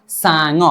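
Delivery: सांग run straight through as one word, "sa-ngo"; low-pitched model सांग, syllables linked; joined pronunciation. Shown as a demonstration. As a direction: say सांग smoothly joined, ng gliding into the final vowel. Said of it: "लेकिन सीधा बोलेंगे".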